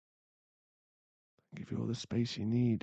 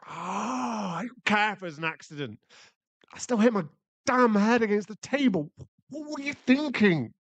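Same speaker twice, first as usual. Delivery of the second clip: high, loud angry scolding; furious at her; angry rant; 10 semitones higher, 6 dB louder than usual. Acted. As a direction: tear into her out of pain. Berating partner after injury.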